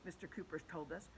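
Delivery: usual speaking voice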